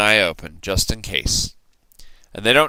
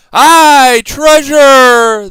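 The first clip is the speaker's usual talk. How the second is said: much higher, loud cheering shout; crew shout; 14 dB louder, much slower than usual. A narrator's reading. Pirate crew cheering for treasure, loud high shout. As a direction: roar it like a greedy crew. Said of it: "the others shouted".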